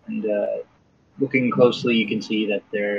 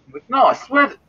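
high, louder acted protest; insistent protest; 5 dB louder than usual. Passer-by swears to doubting friend he saw someone, insistent. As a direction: protest in a raised, high-pitched voice.

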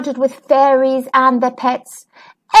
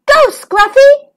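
high, louder cheering shout; 12 semitones higher, 5 dB louder than usual. Acted. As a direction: cheer the dragon on, pitched up. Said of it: "Twinkle shouted".

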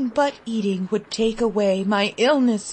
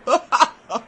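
short amused laugh; 2 semitones higher than usual. A narrator's reading. Gentlemen laugh at boy.

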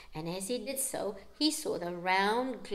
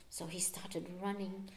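whispered narration; soft tense whisper; 4 semitones lower, 11 dB quieter than usual. A narrator's reading. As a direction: hush the line for suspense. Storyteller hushes.

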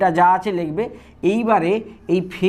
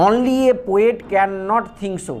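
each word spaced out for copying; slow dictation; much slower than usual. Shown as a demonstration. Teacher dictating an example sentence to students, slow and deliberate. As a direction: read it out slowly for students to write.

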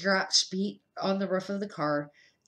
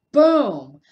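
loud boom; 4 semitones higher, 14 dB louder than usual. A narrator's reading. Storm bang at midnight.

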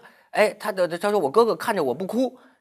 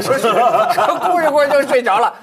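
real laughter breaking into louder, higher speech; laughing while talking; 5 semitones higher, 5 dB louder than usual. Real, not acted. Host retelling how she cried herself asleep, helpless with laughter. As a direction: crack up while delivering the punchline. Cracking up at the story.

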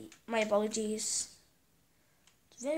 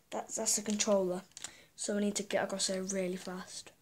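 ordinary delivery

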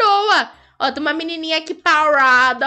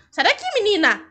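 ordinary talk

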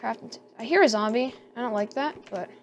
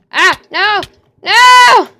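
high, very loud terrified no; real panic as a mob attacks; panicked scream; 10 semitones higher, 17 dB louder, much slower than usual. Real, not acted. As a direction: scream for your life. Monster attacking in the cave.

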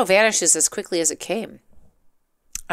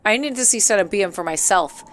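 plain reading voice

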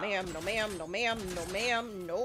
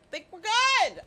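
higher, louder "Think we're good"; a jumpy, raised "good"; nervous burst; 9 semitones higher, 7 dB louder than usual. Real, not acted. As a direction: blurt it out too loud.

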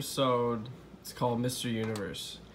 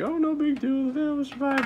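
very high, put-on dad voice; high-pitched mock reassurance; dad impression; 4 dB louder than usual. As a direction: brush it off in a high goofy voice. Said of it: "and his dad's like".

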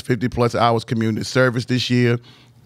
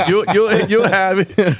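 laughing; 7 semitones higher, 3 dB louder, slower than usual. Real, not acted. Laughing while praising hardware.

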